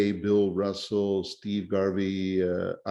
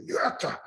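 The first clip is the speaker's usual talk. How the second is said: high mock pitcher's cry; imitated Japanese shout; 10 semitones higher than usual. Imitating Ohtani in Tiger Stadium, high Japanese victory cry. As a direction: yelp it like a pitcher after a throw. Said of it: "it's that Japanese sound".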